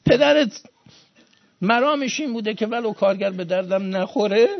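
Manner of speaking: high, loud pulpit exhortation; fiery preaching. Acted. Preacher exhorts mourners to honour their father's workers, fiery preaching. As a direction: drive it home to the congregation with full force.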